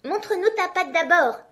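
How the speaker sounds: put-on the kids demand to see the paw; kids shouting. A narrator's reading. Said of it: "crièrent les chevreaux". (les chevreaux crient, voix très aiguë)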